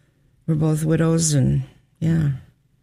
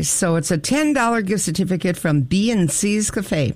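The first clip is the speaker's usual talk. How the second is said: usual speaking voice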